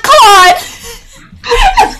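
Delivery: high, loud yelp of his name with a laugh; laughing scolding shout. Retold or imitated.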